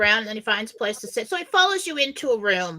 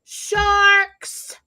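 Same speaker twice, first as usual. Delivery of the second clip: imitating the boat guy yelling sharks, higher; mock shout; 9 semitones higher, 7 dB louder than usual. Boat guy yells a warning to snorkelers, hammed-up shout. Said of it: "starts screaming".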